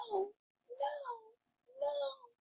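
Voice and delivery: tiny pig. Acted. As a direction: squeak it quietly. Pigs refuse.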